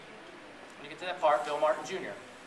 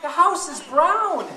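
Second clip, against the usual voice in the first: mouse voice; 10 semitones higher, 9 dB louder, slower than usual. Acted. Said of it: "Lily says".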